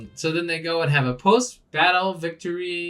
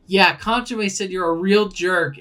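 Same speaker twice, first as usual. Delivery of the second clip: higher, louder bratty taunt; taunting kid; 7 semitones higher, 6 dB louder than usual. Retold or imitated. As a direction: tease him like a bratty kid. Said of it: "And he's like".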